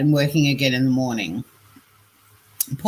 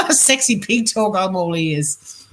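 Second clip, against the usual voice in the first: laughing; 7 semitones higher, slower than usual. Real, not acted. Laughing at scammer's message.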